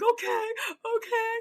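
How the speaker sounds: high, teary okays; crying okays; tearful. Retold or imitated. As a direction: agree through tears. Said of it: "And I'm just like".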